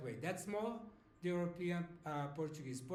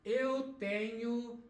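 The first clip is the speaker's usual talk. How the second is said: slow model phrase; 6 semitones higher, 4 dB louder, slower than usual. Shown as a demonstration.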